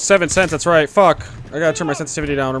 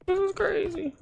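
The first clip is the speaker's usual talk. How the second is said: much higher, softer squeak; squeaky voice; 10 dB quieter than usual. Real, not acted. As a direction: squeak it in silly disbelief. Whispered then squeaked repeat.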